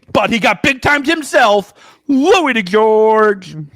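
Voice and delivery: slow, drawn-out name callout; announcer introduction. Acted. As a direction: stretch the name like a ring announcer.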